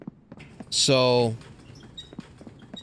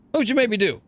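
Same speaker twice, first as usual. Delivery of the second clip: ordinary talk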